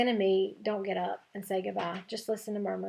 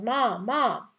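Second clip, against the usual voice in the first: yelling for mom; 3 semitones higher, 5 dB louder than usual. A narrator's reading. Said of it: "then yells".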